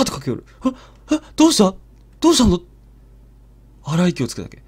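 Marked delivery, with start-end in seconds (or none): 1.1-2.6 alarmed call. Retold or imitated. 二人で駆け寄る.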